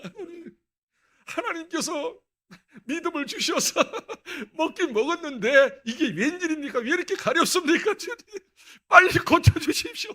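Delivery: high, loud, laughing plea; desperate prayer. Retold or imitated. Itchy teen begging God for relief, high and laughing. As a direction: beg God half laughing, rising.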